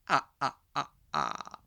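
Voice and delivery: low mock Dracula laugh; vampire cackle. Teacher hamming up a vampire laugh for the class.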